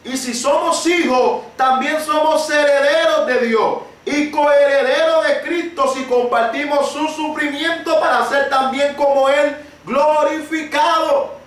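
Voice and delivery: rising, fervent preacher voice; building sermon cry; preaching. Acted. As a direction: preach it out, building to a slow shout. Preaching at full force.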